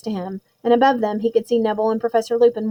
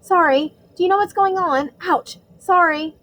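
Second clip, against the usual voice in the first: put-on lightly raised flustered boy voice; Neville voice; 8 semitones higher than usual. A narrator's reading. Boy stumbling on a dark train, flustered apology.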